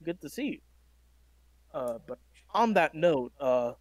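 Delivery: normal talking voice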